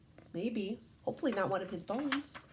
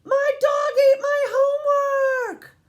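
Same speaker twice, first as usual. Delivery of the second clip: put-on very high, loud, drawn-out kid wail; stretched, high little-boy shout; David's excuse; 13 dB louder, slower than usual. A narrator's reading. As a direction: yell the excuse like a child. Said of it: "He says".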